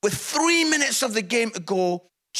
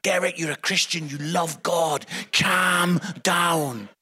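lower-pitched, measured pleading; retold calming; 5 semitones lower than usual. Comedian calming his agitated friend Derek, low and soothing.

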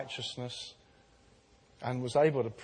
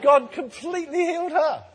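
excited; 13 semitones higher, 8 dB louder than usual. Real, not acted. Climax of healing testimony.

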